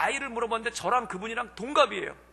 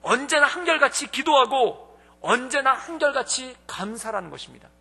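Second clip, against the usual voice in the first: louder, emphatic refrain of 언제나 한결같이; impassioned preaching; 5 dB louder than usual. Acted. Preacher urging the congregation toward constant joy, fervent. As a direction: drive the refrain home.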